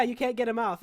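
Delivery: normal talking voice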